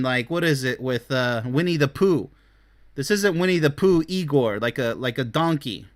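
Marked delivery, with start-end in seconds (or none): none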